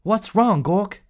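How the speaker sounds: high called-out question; shouted question. Acted. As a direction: call out in worry across the room. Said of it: "they shouted".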